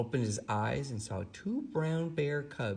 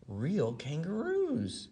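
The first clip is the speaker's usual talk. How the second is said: excited cry; 7 semitones higher, slower than usual. Acted. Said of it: "he cried".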